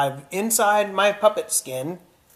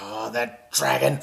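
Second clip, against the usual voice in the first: grumbling king; 3 semitones lower than usual. Acted. King angry at dragon.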